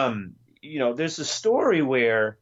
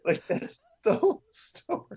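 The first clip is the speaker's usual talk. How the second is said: much higher, laughing through it; laughing disbelief. Real, not acted. Host laughing to listeners about the story, in disbelief. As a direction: laugh at how little there is. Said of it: "if you think I'm exaggerating".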